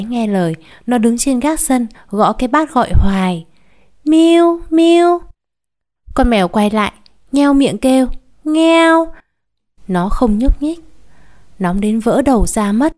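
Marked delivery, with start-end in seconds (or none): 4.0-5.2 high, loud coaxing call; girl calling kitty from the rooftop, high call; calling the cat. Acted. 8.4-9.1 high, loud meow; a cat mewing sharply; imitated cat cry. Cat meows back.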